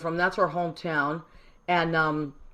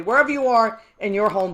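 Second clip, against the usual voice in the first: ordinary talk, nothing marked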